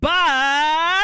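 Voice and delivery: "But" blasted loud and long; stress on BUT. Shown as a demonstration. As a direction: stretch "But" into a rising, emphatic shout. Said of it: "stress on the first word".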